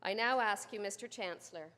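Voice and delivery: ordinary talk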